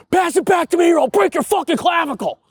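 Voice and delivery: high, loud mock threat from a rough hockey player; tough guy Crash. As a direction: snarl it like a hothead on the rink. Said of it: "a guy named Crash ... that just goes".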